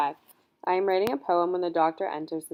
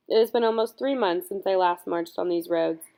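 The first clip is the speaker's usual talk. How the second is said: usual speaking voice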